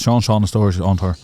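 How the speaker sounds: segment title rattled off quickly; fast tongue twister. Shown as a demonstration. Host showing the title is hard to say, rattled off fast. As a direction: run the title's words together. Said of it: "Say that really fast".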